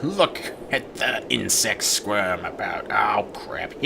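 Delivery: very low gloating villain. Acted. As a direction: sneer it like a dark overlord.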